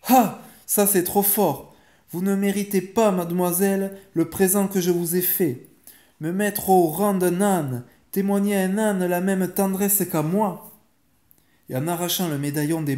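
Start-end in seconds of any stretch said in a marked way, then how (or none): 0.1-10.7 loud, high-pitched angry scolding; indignant tirade, emphatic and raised; outraged. A narrator's reading. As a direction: scold the daughter, deeply offended. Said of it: "avec emportement".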